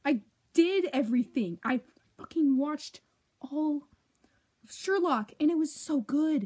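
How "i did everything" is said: ordinary talk, nothing marked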